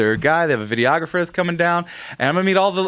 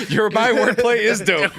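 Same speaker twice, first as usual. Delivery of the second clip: laughing mock quote; 6 semitones higher, slower than usual. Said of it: "William Shakespeare?".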